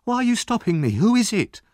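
put-on high, alarmed boy protesting; struggling cry. A narrator's reading. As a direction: protest high-pitched, struggling to get free. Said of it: "he cried, struggling".

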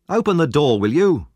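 loud shout through a keyhole. A narrator's reading. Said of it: "shouted a voice".

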